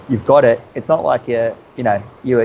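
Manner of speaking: normal talking voice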